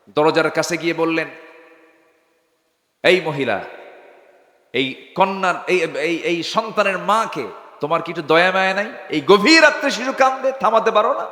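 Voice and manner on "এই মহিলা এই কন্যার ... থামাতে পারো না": acted rebuke climbing high; retold scolding. Omar scolds a mother over her crying child, acted scolding. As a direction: scold her, building to a high-pitched reproach. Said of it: "ধমক দিলেন".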